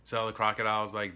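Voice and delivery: normal talking voice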